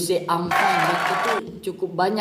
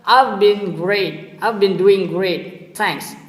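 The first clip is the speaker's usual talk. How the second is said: slow clear model; 6 dB louder, much slower than usual. Shown as a demonstration.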